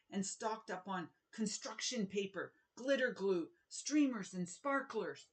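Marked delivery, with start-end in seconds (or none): none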